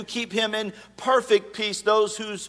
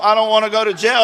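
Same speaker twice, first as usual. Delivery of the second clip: ordinary talk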